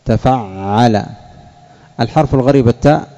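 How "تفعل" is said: تفعل stretched out slowly, each syllable clear; teacher spelling out تفعل at dictation pace; slow pattern demo. Shown as a demonstration. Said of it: "تصبب على وزن ماذا؟".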